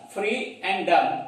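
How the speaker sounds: freedom broken slowly into free, and, dumb; slow syllable split. Shown as a demonstration. Teacher splitting freedom into two syllables for class, slow. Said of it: "two syllables".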